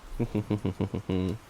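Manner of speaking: nasal villain cackle done on purpose; Waluigi laugh. Acted. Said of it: "when I do the Waluigi laugh".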